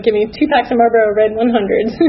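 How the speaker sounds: laughing through the line; real laughter breaking into the words. Real, not acted. Woman retelling buying cigarettes after bad news, laughing. Laughs while quoting her order.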